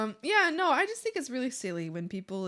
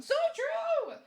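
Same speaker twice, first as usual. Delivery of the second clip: delighted agreement. Real, not acted. Agreeing with chat.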